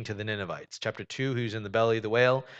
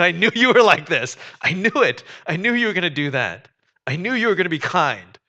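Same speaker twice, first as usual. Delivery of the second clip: higher, much louder furious outburst; furious Jonah; 6 semitones higher, 8 dB louder than usual. Retold or imitated. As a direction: spit it out at God in frustration. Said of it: "makes Jonah furious".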